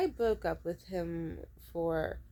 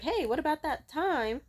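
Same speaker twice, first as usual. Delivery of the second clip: higher, louder inner voice butting in; pointed reminder, pitched up; nagging intuition; 7 semitones higher, 4 dB louder than usual. Acted. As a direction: play intuition raising an objection. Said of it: "my intuition would be like".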